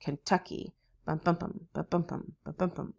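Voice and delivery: "Kentucky. Bum, bum, bum" in the Kentucky rhythm, ken-TUCK-y; soft rhythmic bums set against the Tennessee beat; S3 gallop beat. Shown as a demonstration. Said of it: "That's a little bit different than Kentucky".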